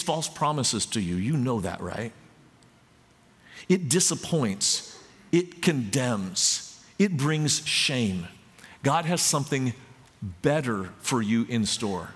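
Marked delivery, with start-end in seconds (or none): 3.7-8.3 each short phrase slowly weighted; slow and emphatic. Acted. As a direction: lay down every word slowly. Preacher's slow emphatic list.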